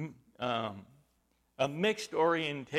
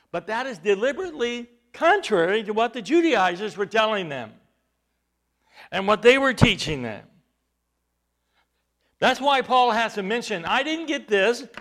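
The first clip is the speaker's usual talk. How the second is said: louder, rising preacher's cry; fervent preaching; 5 semitones higher, 8 dB louder than usual. Acted. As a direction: build the case to a peak. Fervent preaching, voicing Paul.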